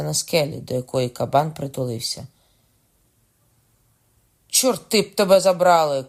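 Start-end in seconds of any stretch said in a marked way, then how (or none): 4.5-6.0 loud, high outburst; high cry tumbling down in pitch; shouted curse. A narrator's reading. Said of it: "крикнув король".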